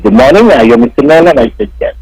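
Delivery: ordinary delivery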